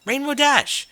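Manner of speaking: Rarity calls out Rainbow's name in delight; sultry mare voice. Acted. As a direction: call her name in delight, loud and high. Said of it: "a mare's low, sultry voice".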